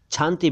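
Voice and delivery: शांति said as "chhanti"; wrong chh onset. Shown as a demonstration. Teacher rejecting second mistake: शांति as "chhanti". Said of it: "शांति भी नहीं".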